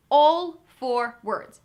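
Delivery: slow, loud and stretched; drawn-out announcement. Acted. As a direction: stretch each word out like a big reveal. Teacher wraps up word list.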